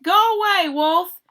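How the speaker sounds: high, loud yell of a scared pig. Acted. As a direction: shout the wolf away from the door. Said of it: "He yelled back".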